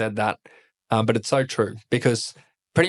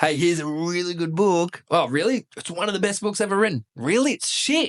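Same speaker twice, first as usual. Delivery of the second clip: higher-pitched mock dialogue, rising to indignation; mock book chat; 6 semitones higher than usual. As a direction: act out a hyped book and the disgusted reader. Acts out book recommendation and letdown.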